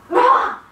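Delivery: high, loud yelp of frustration; exasperated outburst at your own slip; frustrated "ah". Real, not acted. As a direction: cry out, annoyed with yourself.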